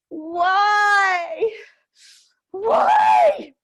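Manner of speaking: high, loud cries of why breaking into sobs; anguished shout. Real, not acted. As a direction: scream out the powerlessness and rage. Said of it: "express the unexpressed feelings of rage".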